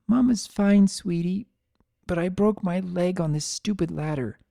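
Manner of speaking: calm the scared foals despite the broken leg; reassuring hurt mother. A narrator's reading.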